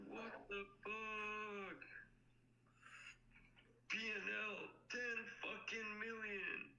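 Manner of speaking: high, slow, amazed; stunned disbelief. Real, not acted. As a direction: stretch it out, can't believe it. Stunned by the profits.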